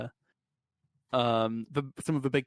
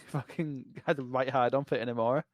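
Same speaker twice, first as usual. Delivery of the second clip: normal talking voice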